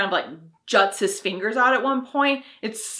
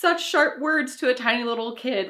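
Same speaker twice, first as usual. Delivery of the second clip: usual speaking voice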